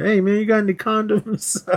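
high, eager mock question. Retelling Day Day's interruption, high cheeky voice. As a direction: ask it with a cheeky squeak.

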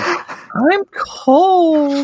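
put-on high eerie ghost-child whine; ghost kid voice.

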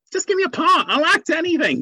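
high, eager plea; eager pleading. Retold or imitated. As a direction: beg for any part. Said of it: "I just felt".

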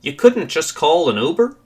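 high, swooping scornful question; scoffing. A narrator's reading. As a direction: scoff in disbelief, pitch rising at the end. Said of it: "and scoffs".

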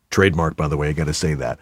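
dropped low and soft, a throwaway disclaimer; quiet aside. Real, not acted. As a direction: mutter it under your breath.

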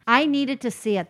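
normal talking voice

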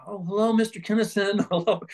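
high, tentative hello, lightly hammed-up; timid page greeting. Page told to eject famous comics, timid high greeting.

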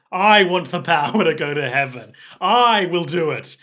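villain boasting about heaven to himself; villain declaration. Acted. Villain voiced declaring his goal.

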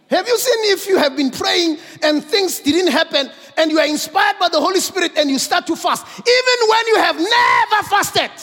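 high, loud rising preacher's cadence; preaching build. Acted. The preacher to the congregation, building high and loud. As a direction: drive it up to the climax.

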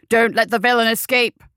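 loud captain's bellow; rally the men at volume; urgent roaring command; roared order. A narrator's reading. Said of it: "he roared".

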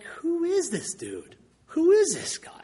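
high, slow, puzzled who-is-this; baffled. Retold or imitated. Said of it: "at first I was like".

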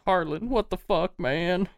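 high-pitched protesting henchman; distressed henchman. Acted. Wounded man appealing to Harlan: high distressed protest. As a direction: protest high to your boss.

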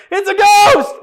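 very high, loud comic shriek; hammed-up squealing yell of fright; Matthew's panicked shout. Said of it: "just Matthew going like".

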